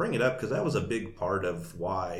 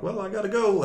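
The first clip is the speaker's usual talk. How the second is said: hasty exit; 13 semitones higher, 7 dB louder than usual. Retold or imitated. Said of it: "I'm like".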